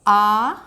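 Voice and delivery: teacher demonstrating a long आ; long vowel aa. Shown as a demonstration. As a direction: sing out a full, long आ. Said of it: "दीर्घ".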